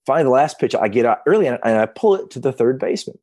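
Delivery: usual speaking voice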